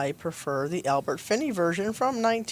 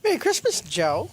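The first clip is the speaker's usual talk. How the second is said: cheerful greeting; 6 semitones higher, 3 dB louder than usual. Acted. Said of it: "school cafeteria scene".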